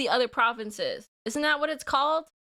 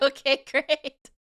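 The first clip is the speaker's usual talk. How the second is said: dry okay great; 2 semitones higher, 4 dB louder, slower than usual. Retold or imitated. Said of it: "was like".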